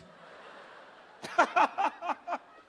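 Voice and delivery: high, long burst of laughter; real laugh. Real, not acted. Preacher laughing at his own predicament, high real laughter. Breaks into laughter mid-story.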